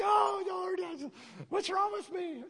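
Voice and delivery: high, mock-anguished cry to the Lord; a lightly hammed-up plea; exasperated plea. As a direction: act out the frustrated tears. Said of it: "I'm like".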